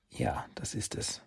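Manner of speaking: soft unvoiced whisper. Shen answers the master in a whisper. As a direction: whisper the yes.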